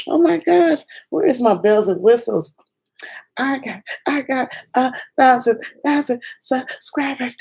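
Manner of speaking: high-pitched thrilled squeal; real excitement, voice jumping high; excited. Real, not acted. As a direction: gush like you just hit a milestone. Thrilled at thousand subscribers.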